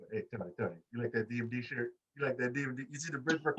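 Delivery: softly imitated chummy murmur; mock confiding aside. Wrestler chatting up her announcer friend, soft mock aside.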